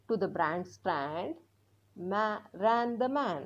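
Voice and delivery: very slow, careful reading; reading aloud slowly. Shown as a demonstration. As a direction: read the line out slowly again. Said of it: "आता आपण हे मोठ्याने वाचणार आहोत". (पुन्हा हळू वाचन)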